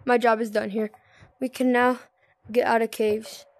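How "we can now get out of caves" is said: ordinary talk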